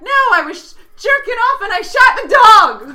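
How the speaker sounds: very high, loud frantic blurt; panicked kid confession. Retold or imitated. Punchline in kid voice.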